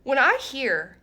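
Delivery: normal talking voice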